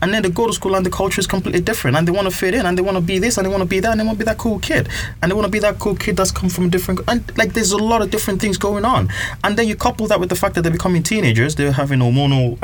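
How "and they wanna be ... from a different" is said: ordinary talk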